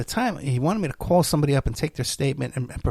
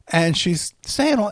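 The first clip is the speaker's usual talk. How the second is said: ordinary delivery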